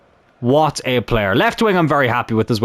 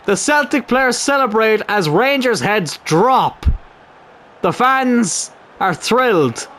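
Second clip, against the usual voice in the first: slow, drawn-out commentator delivery, pitched up; post-goal match commentary; 6 semitones higher than usual. Pretend.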